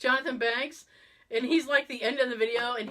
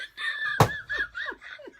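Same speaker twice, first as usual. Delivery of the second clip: high helpless laugh; a real high-pitched giggle; burst of laughter. Real, not acted. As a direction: crack up at the punchline. Laughing at the punchline.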